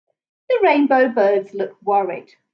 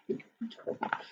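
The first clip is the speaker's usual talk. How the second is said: whispered aside; 18 dB quieter, faster than usual. A narrator's reading. Unvoiced aside to listener.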